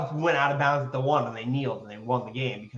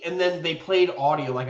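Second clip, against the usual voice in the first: normal talking voice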